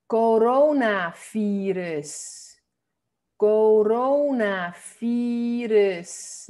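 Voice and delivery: coronavirus, very slow, ending "fee-res"; Dutch pronunciation. Shown as a demonstration. Teacher showing how Dutch says "coronavirus", very slow, "fee-res". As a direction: stretch "coronavirus" out syllable by syllable with a weak final vowel. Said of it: "en holandés no decimos virus".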